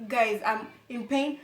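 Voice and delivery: ordinary delivery, nothing marked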